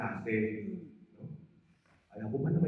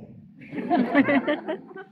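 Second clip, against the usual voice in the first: high, light chuckle; high breathy chuckle; light laugh; 13 semitones higher, 5 dB louder than usual. Real, not acted. Laughs at own story.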